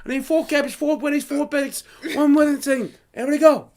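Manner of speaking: high chef voice calling the ticket; mock head-chef bark; imitated order-calling. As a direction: call out orders to the kitchen.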